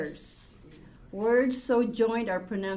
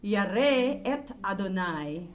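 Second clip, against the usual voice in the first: slow reading; much slower than usual. Shown as a demonstration. Reads Hebrew verse slowly.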